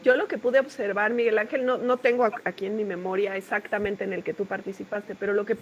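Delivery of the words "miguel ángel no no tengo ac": ordinary talk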